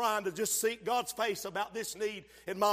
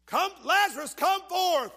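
loud call; 10 semitones higher, 8 dB louder than usual. Acted. Jesus calling Lazarus by name, loud call. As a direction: Come forth called high and loud.

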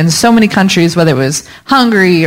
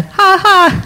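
high teasing "ha ha" for God; a playful divine chuckle, pitched up; mock Lord laughing; 11 semitones higher than usual. Quoting the Lord teasing a planner, high and playful. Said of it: "but the Lord said".

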